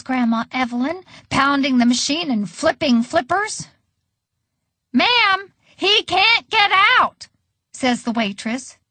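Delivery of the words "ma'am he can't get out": high, slow, emphatic plea; insistent. A narrator's reading. Waitress to Grandma about trapped Edward, insistent. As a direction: spell out the emergency to someone not listening.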